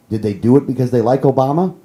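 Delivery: usual speaking voice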